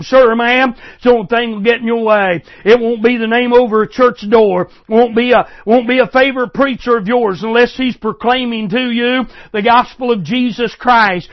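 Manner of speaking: normal talking voice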